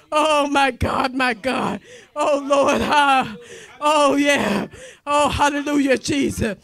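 normal talking voice

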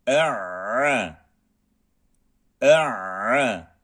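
"E'a" with a tight throat squeeze before a; Arabic ayn. Shown as a demonstration. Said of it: "Ayn harfi şeddeli".

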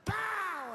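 loud, high bang noise; imitated blast. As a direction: burst it out like an explosion. (estallido imitado, fuerte y agudo)

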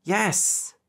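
high, insistent answer; insistent yes. A narrator's reading. Ricky insists he is sorry.

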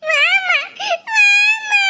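high tearful wail; crying child. Acted. Boy sobbing down the phone for his mother, high and tearful. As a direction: beg mum to come through sobs. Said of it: "இந்த பையன் ஒரே அழுக".

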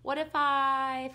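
put-on high, loud, slowly drawn-out child's plea; pestering boy. A narrator's reading. Boy begins another stunt offer, loud drawn-out pestering. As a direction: stretch it out high like a kid with an idea.

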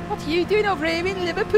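very high-pitched comic Scouse; Scouse accent. Retold or imitated. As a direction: imitate a comedian's squeaky Liverpool local. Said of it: "was the perfect Scouse".

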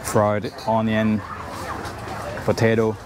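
ordinary talk

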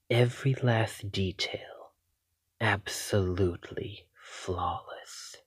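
soft, covetous whisper. Acted. Vampire admiring her captive's blood, soft whisper. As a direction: hush it like a secret treasure.

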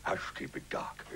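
low, slow, hushed growl of secrecy; low, stiff-jawed confidential voice; character voice. Shown as a demonstration. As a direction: talk softly through a fixed jaw. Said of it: "a man who talked like that".

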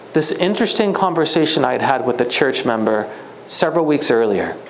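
ordinary talk

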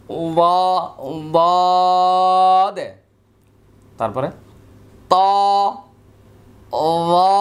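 each letter said slowly and heavily, lips kept straight and never rounded; letters without rounding. Shown as a demonstration. Teacher showing students letters with straight lips, drawn out. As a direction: draw out every letter with flat, unrounded lips. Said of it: "একদম সোজা থাকবে".

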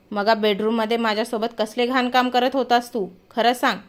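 ordinary delivery

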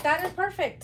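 light high retold delight. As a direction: replay the thrill, lighter. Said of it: "I was like".

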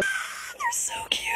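high, slow, breathy whisper of delight; hushed squeal over something adorable; breathless whisper. Real, not acted. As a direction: whisper it like it's too cute to bear. Gushing over decorated Crocs.

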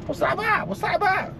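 high mock-outraged what's that about; incredulous squeak. Real, not acted. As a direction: squeak it up in disbelief. Baffled by tiny hat.

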